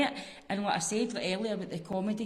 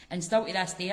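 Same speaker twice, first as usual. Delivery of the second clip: ordinary delivery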